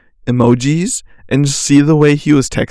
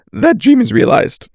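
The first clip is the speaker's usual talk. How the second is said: high-pitched, cracking with determination; 10 semitones higher than usual. Real, not acted. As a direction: voice cracks high on a hopeful declaration. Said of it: "I think my voice just cracked".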